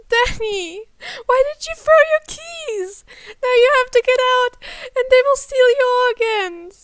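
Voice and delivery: high, loud frantic scolding; scolding little sister. Acted. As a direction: scold her brother in alarm. Girl scolding panicked brother.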